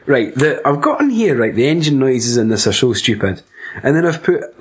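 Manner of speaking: normal talking voice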